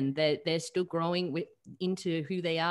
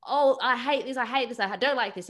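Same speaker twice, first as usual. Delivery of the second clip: higher, louder mock exasperation; worked-up rant; 8 semitones higher, 7 dB louder than usual. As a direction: act out a client's escalating list of hates. Client's rant, acted out.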